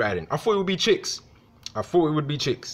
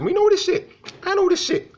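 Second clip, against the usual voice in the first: high emphatic outburst; 4 dB louder than usual. Real, not acted. Angry emphatic rant peak.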